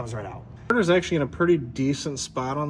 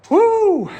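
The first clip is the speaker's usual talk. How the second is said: loud high whoop of joy; excited cheer; 11 dB louder than usual. Real, not acted. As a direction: let out a big happy whoop. Celebratory cheer in shop.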